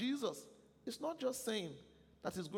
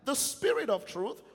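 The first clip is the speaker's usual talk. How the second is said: reading scripture aloud at full force, higher; loud scripture reading; 6 semitones higher, 7 dB louder than usual. Acted. Preacher leads the church reading scripture, loud and ringing. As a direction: proclaim the verses with the congregation.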